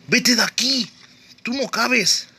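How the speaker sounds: high, urgent shouting. Real, not acted. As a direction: yell at them to get out. Yells at player in hiding spot.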